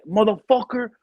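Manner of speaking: high, loud retold curse; gunny going off. Gunny curses his assistant on the phone, going off. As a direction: hurl it down the phone like an irate gunny. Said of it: "this guy always went off the chain".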